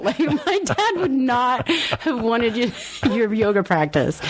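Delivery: laughs through the words, slow; giggling while telling it; laughing. Real, not acted. Friend retells a dead dad's story, laughing.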